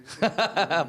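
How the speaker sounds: high-pitched burst of laughter; real high laugh. Real, not acted. Amused by the teacher's reproach.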